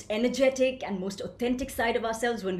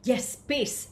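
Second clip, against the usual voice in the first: normal talking voice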